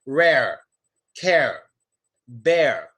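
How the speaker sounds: "rare", "care", "bear" with pulled-back final R; strong R. Shown as a demonstration. Teacher showing stronger R, tongue pulled back. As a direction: draw the tongue back, low pitch, on "bear". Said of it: "make the R sound strong".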